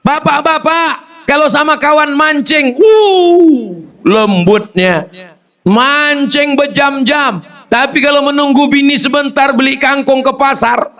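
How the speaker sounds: preaching at full force. Acted. Preacher scolding the husbands in a high, ringing voice. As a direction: preach it up to the rafters.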